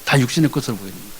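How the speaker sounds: hushed quick repeat; quiet echo. Shown as a demonstration. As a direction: echo it under the breath. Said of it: "repeated line".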